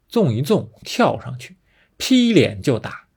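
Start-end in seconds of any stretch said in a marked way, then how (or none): none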